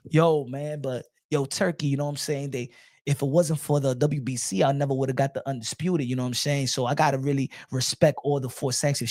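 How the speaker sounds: low, soft, half-whispered humble fighter; mock meek Crawford. Crawford answering Turkey, low and hushed. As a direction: mumble it back sheepishly. Voicing Crawford replying meekly.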